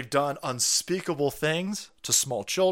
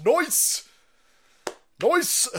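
excited cheer; 11 semitones higher than usual. Real, not acted.

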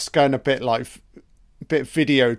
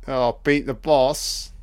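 ordinary talk, nothing marked

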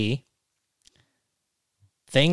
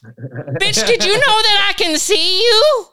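very high, louder squeal; mock creepy quote; 6 dB louder, slower than usual. Mocking a Hollywood insider, high squeal. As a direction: shriek it in a mocking falsetto. Acted Cat Williams style quote.

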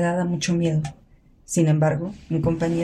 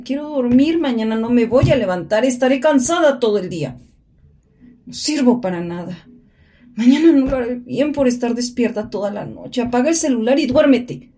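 higher and louder, agitated; frantic self-scolding; 6 semitones higher, 4 dB louder than usual. Acted. Sleepless woman scolding herself at night, frantic. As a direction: berate yourself for staying awake.

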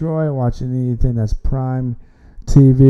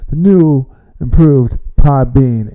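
loud showman podcast intro; grand entrance announcement; 5 dB louder than usual. Acted. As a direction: announce it like a host.

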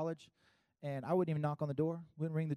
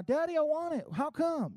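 put-on high, insistent kid's whine; whining child; 11 semitones higher, 4 dB louder than usual. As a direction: demand it like a stubborn kid. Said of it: "you're like".

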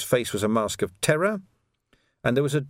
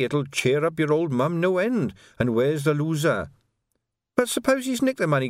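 lightly voiced bribe-taking corporal, higher in places; chatty corporal with a raised, lilting pitch; corrupt corporal; 5 semitones higher than usual. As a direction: talk a rookie into sharing a bribe. Corporal's wheedling talk in story.

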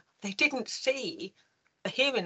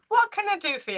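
head teacher voice; 10 semitones higher than usual. Retold or imitated. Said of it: "And he said".

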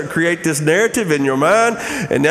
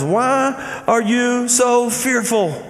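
slow, higher-pitched pointed question; slow, aggravated questioning; 7 semitones higher, much slower than usual. Acted. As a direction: draw out each word in mild reproach. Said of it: "we can see a little tone when Jesus says".

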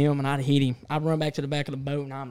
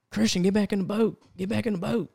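higher, urgent mock yell; retold screaming; 7 semitones higher than usual. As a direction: call him back to the boat, pitched high. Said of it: "Mitchell just kept screaming".

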